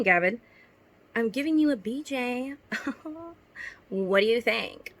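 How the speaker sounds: lightly performed read-aloud of a cringey story; reading fanfic aloud. Acted. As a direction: read it like a story you're embarrassed by. Reads her old fanfic aloud.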